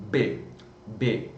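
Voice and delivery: B sound for Spanish V; V spoken as B. Shown as a demonstration. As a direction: model the B sound. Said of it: "som mais chegado ao B".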